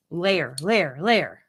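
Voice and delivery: Lair drilled as lay-er over and over; repeating Lair. Shown as a demonstration. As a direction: practise Lair again and again. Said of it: "fighting with that word".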